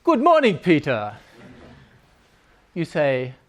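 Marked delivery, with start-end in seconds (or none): 0.0-1.1 mock-keen colleague's hello; colleague Klaus. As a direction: greet a coworker brightly, voice leaping up. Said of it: "Klaus says".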